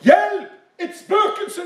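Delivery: high-pitched mock scream for help; retold panicked cry. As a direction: squeal it in acted fright. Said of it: "For de ropte".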